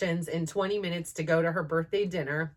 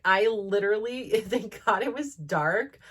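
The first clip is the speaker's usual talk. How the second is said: ordinary delivery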